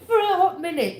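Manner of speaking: laughing it out despite herself; giggling over the phrase. Real, not acted. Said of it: "And I'm laughing".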